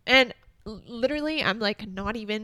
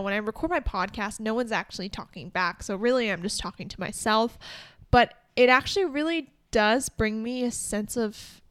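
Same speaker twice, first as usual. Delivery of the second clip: ordinary talk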